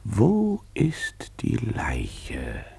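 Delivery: slow, hushed whisper of a mystery; whispered, drawn-out suspense; dramatic whisper. Acted.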